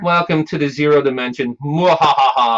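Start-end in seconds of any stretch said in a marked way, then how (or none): 1.6-2.6 evil villain laugh. Acted.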